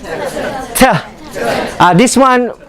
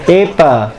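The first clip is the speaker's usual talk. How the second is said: ordinary talk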